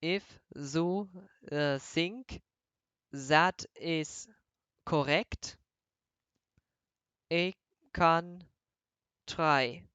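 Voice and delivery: slow, word-by-word thick German accent; different German accent. Shown as a demonstration. Streamer showing viewers how another German accent sounds, halting exaggerated accent. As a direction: lay on a German accent with z for th, pausing between words. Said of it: "have you heard how different that sound".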